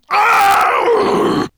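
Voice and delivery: high, loud roar of frustration; furious roar. A narrator's reading. Dragon raging at the vanished trail, furious roar. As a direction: roar out your rage.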